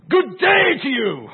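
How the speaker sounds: very high, loud, drawn-out hail; hammed-up shouted greeting. Preacher going up to French people, hammed-up shouted greeting. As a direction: shout it slowly, absurdly over-keen. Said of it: "Yes, like that".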